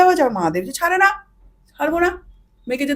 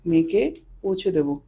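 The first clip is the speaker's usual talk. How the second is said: 1 quiet command; 8 semitones lower, 7 dB quieter than usual. Retold or imitated. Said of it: "বাবা আর কি আদেশ দিলেন".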